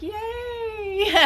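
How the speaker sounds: high mock cheer through a laugh. The family cheering over sugar-free Bomb Pops, high laughing cheer. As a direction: cheer like the whole family at once. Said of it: "everybody's like".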